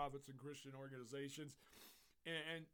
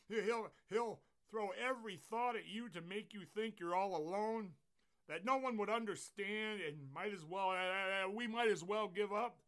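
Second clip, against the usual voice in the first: loud, raised, urgent sermon voice; full-force preaching; 9 semitones higher, 12 dB louder than usual. Acted. Pastor warns congregation about the devil, preaching hard. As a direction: build force like a fired-up preacher.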